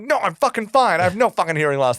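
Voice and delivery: a customer insulted by the hearing aid call, mock furious; mock angry denial. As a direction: play an offended customer snapping back. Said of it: "I'd be like".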